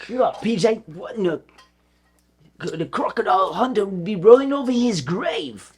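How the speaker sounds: hammed-up Aussie commentator; teasing a friend's trick in an Australian accent. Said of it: "I started doing an Australian accent".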